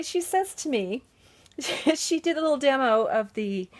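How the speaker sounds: ordinary delivery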